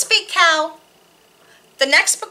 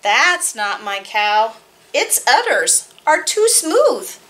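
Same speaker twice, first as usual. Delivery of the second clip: put-on reading to children; slower than usual. Reads board book to children.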